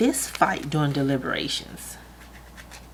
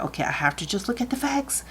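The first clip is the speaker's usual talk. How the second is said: inner voice; 8 semitones higher, faster than usual. Retold or imitated. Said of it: "it was like".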